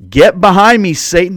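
a sharp rebuke thrown at a close friend; forceful rebuke. Acted. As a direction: bark it at Peter like a command. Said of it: "There's an exclamation point there".

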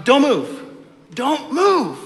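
high, loud, sharp don't; urgent parent warning. Retold or imitated. Parent with full hands warning toddler, high and loud. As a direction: stop the child mid-step. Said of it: "and I'm".

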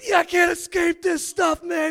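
slow, high, loud mock shout; yelled. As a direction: imitate an overwhelmed man yelling in disbelief. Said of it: "he's, he yelled".

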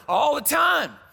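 loud high shouted answer; congregation response. Retold or imitated. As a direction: shout the response back as a crowd. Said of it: "the congregation says".

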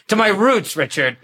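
ordinary talk

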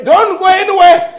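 high, urgent mock phone plea; pleading relatives. Relatives phoning the preacher about holidays, imagined high-pitched pleading. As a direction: call out imploringly, pitched well up. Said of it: "they should be phoning you and say".

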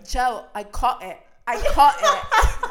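loud, slow mock-British retort, rising high; Freya arguing back. Girlfriend answers screaming boyfriend in the cab, loud British protest. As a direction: insist loudly in a put-on British accent. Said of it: "And then Freya was like".

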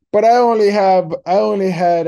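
put-on high, loud slurring drunk. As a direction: whine it out like a tipsy passenger. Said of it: "drunk voice".

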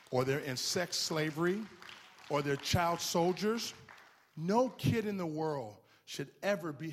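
loud, slowing preaching outcry; full-force preaching. Acted. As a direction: cry it out to the church, slowly.

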